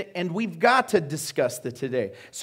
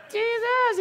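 very high-pitched strained cry; hammed-up high cry for help. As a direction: call out desperately from the crowd. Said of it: "demonstrate what that sounds like".

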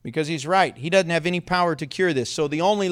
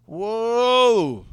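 loud, long drawn-out "whoa"; stretched-out awe, pitched up; impressed whoa; 4 semitones higher, 4 dB louder than usual. Acted. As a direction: marvel at it with one big swooping whoa. Reacting to the prophet's bold claim.

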